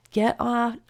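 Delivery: usual speaking voice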